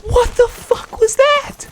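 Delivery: very high, loud shocked shriek; raging gamer. Real, not acted. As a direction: shriek it in disbelief, slow.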